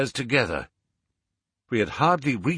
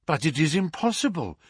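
lightly voiced woman, higher pitch; higher, mildly protesting female character; Miss Hunter; 6 semitones higher than usual. A narrator's reading. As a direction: raise the voice a little into hers. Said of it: "said Miss Hunter".

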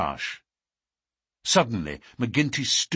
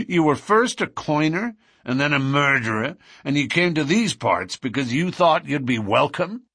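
higher, louder, amused lodge boss; 5 semitones higher, 4 dB louder than usual. Acted. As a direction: relish the newcomer's nerve, voice raised. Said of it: "McGinty laughed".

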